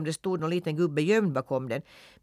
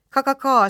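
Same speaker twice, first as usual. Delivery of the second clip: high, loud hen cackle; cackling hens; 10 semitones higher, 10 dB louder than usual. A narrator's reading. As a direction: squawk it like a flock of hens. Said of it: "skrek hönsen".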